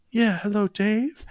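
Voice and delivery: high, soft-spoken pop star voice. Retold or imitated. Caller greets the radio host live, high soft star impression. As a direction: lift it high and keep it gentle. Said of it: "this person is spot on [name]".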